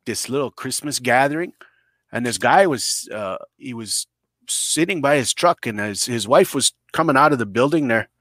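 ordinary talk, nothing marked

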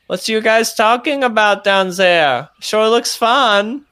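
calling down from above. Acted. NPC calls down from tower.

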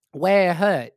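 loud mock Chinese accent; imitating Chinese-accented old lady. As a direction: ask it in a put-on Chinese accent, dropped r's. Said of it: "old lady, same lady".

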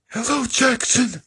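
high, slow Siri imitation on an in-breath; Siri voice impression. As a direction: suck in breath while speaking like a squeaky Siri. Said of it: "his Siri voice, where he sucks in breath".

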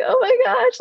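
high, mock-tearful gasp of joy; lightly acted weepy excitement; retold happy crying. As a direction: imitate being overcome with happy tears. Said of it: "like, I'm just crying".

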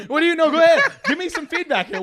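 egging the listener on; host teasing cohost to guess; laughing excitement. Real, not acted.

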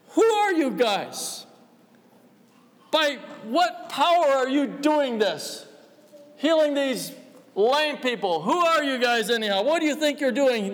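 a hint of an indignant priest; stern high priest. High priest interrogating apostles.

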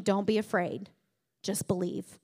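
calm the frightened listener gently; tender reassurance. Retold or imitated. Jesus speaking to the listener.

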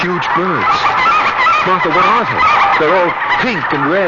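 high and loud with rising alarm. Acted. Husband calling to his wife about the birds, alarmed. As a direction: call out in growing panic.

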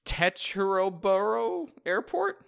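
slow, rising, unsure try at Teterboro Airport; tentative first stab at a place name; unsure reading. Real, not acted. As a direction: sound out Teterboro as a question. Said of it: "I don't know what that word is".

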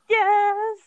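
high, loud triumphant yes; gleeful cheer. Retold or imitated. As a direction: cheer like your enemy just fell. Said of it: "that's immediately where I went".

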